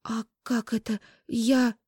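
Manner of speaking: breaking off mid-thought; stunned babble. A narrator's reading. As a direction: lose your voice in shock. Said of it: "пролепетала Даша".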